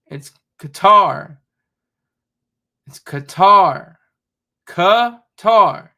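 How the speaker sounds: "Qatar" said kuh-TAR, loud and slow, stress on the second syllable; correct pronunciation. Shown as a demonstration. Correcting the news anchors, slow loud kuh-TAR for "Qatar". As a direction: drill "Qatar" as kuh-TAR, leaning hard on TAR. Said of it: "Two syllables".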